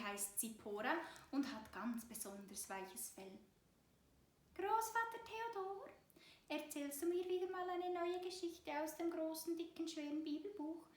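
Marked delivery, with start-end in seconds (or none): 4.5-10.9 high, pleading little mouse voice; begging mouse child. A narrator's reading. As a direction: beg like a tiny mouse child. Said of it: "So bettelt die kleine Zipora". (hohe, bettelnde Mäusekinderstimme)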